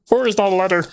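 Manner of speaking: put-on high children's TV puppet voice. Playing along with the Big Bird joke, high puppet voice. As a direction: correct him like a kids' show puppet.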